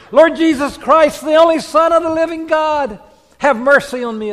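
high, loud fervent cry to God; lifted, loud Orthodox prayer cry; calling out a prayer. Shown as a demonstration. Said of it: "I love the calling of God".

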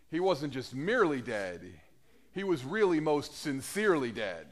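ordinary talk

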